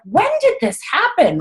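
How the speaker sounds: high-pitched mock astonishment; amazed disbelief. Coach acting out seeing her paycheck, astonished.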